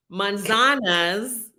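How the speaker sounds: manzanas drawn out very slowly; slow Spanish word. Shown as a demonstration. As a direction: say manzanas syllable by syllable. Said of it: "I learned this whole sentence".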